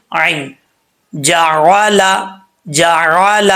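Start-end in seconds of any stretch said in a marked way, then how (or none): none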